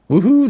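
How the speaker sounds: high, loud woohoo; high celebratory holler; excited whoop. Acted. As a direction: cheer with a rising whoop. Cheer as the playing is recalled.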